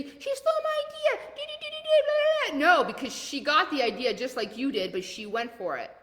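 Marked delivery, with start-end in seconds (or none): 0.0-2.8 very high mocking whine; mock whiny complaint. Mocking a viewer who lost an idea, squeaky whine. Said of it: "this is where you say".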